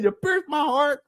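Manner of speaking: high, slow mock woman's voice; mocking her. Repeating her accusation to the chat, slow and high in mockery. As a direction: drag her line out slowly, pitched high. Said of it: "She said".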